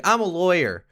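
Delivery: high, mock self-important announcement; imitated boastful girlfriend. Girlfriend pulls rank on him, mock-haughty.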